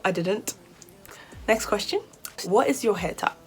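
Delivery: ordinary talk, nothing marked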